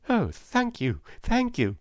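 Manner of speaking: high, excited thanks; grateful cry. A narrator's reading. Scarecrow thanks the wizard for promised brains, crying out gratefully. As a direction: cry out your thanks, pitched well up. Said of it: "cried the Scarecrow".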